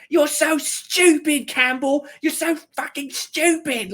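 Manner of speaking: very high, drawn-out mocking kid voice; slow, squealed taunt, imitating playground bullies; jeering mean kids. Kids taunting a teammate after the handball, high mocking jeer.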